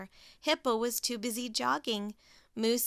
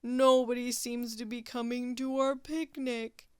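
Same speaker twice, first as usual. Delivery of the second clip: moaning Walrus. Acted. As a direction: moan it like a gloomy walrus. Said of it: "moaned Walrus".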